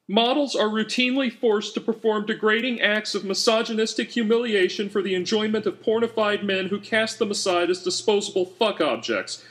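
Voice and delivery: high, shrill, ranting voice for her notes; high-pitched indignant screed; furious strident activist. A narrator's reading. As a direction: spit it out like an outraged campaigner. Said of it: "she scrawled furiously".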